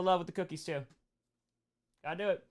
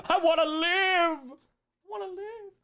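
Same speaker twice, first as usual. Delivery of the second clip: high, drawn-out cry for life; mock plea; 12 semitones higher, 4 dB louder, slower than usual. Joking about the pastor coming along, mock desperate plea.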